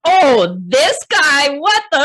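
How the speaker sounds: friend's alarmed outburst. Retold or imitated. A friend recognizing the bad date, loud shock. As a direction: blurt it out in alarm. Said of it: "my friend's like".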